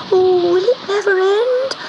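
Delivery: high, wailing little kitten voice; anxious kitten. A narrator's reading. Kitten thinking as he rolls in the storm, anxious and high. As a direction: wail it high like a frightened kitten. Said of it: "thought Gobelino".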